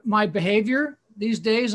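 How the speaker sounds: ordinary talk, nothing marked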